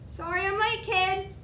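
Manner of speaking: a cheery apology; Gabe's voice. A narrator's reading. Gabe arrives late and apologises to the boy.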